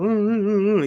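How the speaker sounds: high, loud nervous oohs; anxious whimpering. Retold or imitated. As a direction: whimper like you're scared to go in. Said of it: "and then go".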